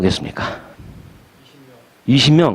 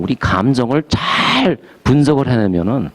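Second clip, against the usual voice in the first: normal talking voice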